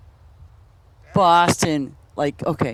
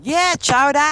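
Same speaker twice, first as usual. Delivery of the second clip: put-on Boston accent; 8 semitones higher, 4 dB louder than usual. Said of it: "another Bostonian thing".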